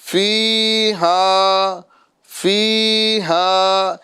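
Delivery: فیہا with the ی held one alif; slow stretched ee in فیہا; drawn-out fiha. Shown as a demonstration. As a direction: practice madd. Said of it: "یہ practice میں لے کر آئیے".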